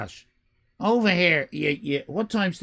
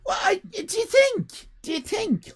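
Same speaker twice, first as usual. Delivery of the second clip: jumps much higher, surprised and offended; mock indignation. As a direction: act stunned by the insult.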